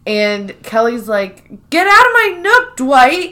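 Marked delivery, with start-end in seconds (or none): 1.7-3.3 high, loud shooing; hammed-up shrill brush-off; mock huffy Kelly. Kelly ordering Dwight out of her nook, high and loud.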